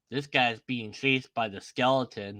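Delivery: ordinary talk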